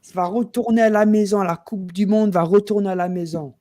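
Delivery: rallying World Cup call said in proud French; speaking French. Shown as a demonstration. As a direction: announce it in French with gusto. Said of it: "get ready for the World Cup in French".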